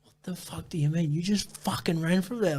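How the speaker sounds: high-pitched mock yell, held down; exasperated yelling. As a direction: yell it in disbelief without full volume. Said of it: "just turned around and yelled".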